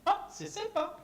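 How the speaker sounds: high, dainty c'est sympa; prim polite French. Retold or imitated. Answering a nasty act with dainty French politeness. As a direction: chirp it sweetly. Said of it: "je te dis".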